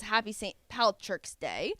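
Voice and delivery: ordinary delivery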